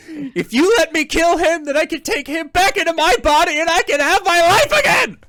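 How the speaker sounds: high, loud, frantic pleading; desperate plea. Acted. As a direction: beg urgently to get your life back. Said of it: "the second one says".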